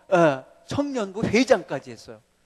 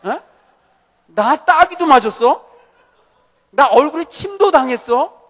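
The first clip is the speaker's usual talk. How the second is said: loud, rising rebuke as Jesus; a hint of the Lord chiding a disciple; voice of the Lord rebuking; 5 semitones higher, 9 dB louder than usual. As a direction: scold him gently but firmly. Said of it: "주님이 그러시는 것 같아".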